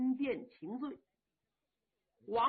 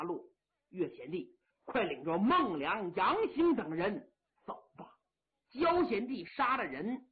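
put-on steady old marshal; 3 semitones lower than usual. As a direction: speak as the grave elder brother calming his men. Yang Liulang voiced in story.

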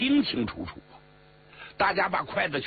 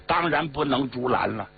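ordinary delivery

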